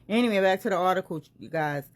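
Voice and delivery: ordinary talk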